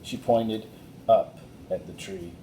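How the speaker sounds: ordinary delivery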